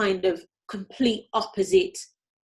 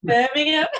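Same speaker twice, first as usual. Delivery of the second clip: playful full Brummie, higher and louder; Brummie accent; 5 semitones higher, 6 dB louder than usual. Shown as a demonstration. Guest echoing host's tease about her hometown, Brummie accent. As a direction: echo it back in broad Brummie, amused. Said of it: "full Brummie accent".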